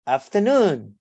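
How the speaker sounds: "afternoon" stressed on NOON, swooping then falling; third-syllable stress. Shown as a demonstration. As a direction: hit the last syllable of "afternoon". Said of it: "primary stress on the third syllables".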